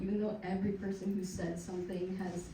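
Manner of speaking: ordinary talk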